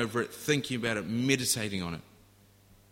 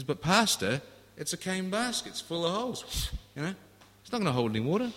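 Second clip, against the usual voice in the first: put-on whiny young man; 8 semitones higher than usual. Young man protesting to pastor.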